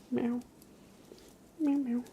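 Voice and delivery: high-pitched mock meowing; playful kitten meows. Meowing at the cat.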